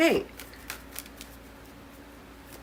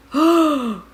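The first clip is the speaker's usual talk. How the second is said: high, loud, drawn gasp; surprised gasp; 8 semitones higher, 7 dB louder than usual. Real, not acted. Tarot reader seeing the next card, surprised gasp.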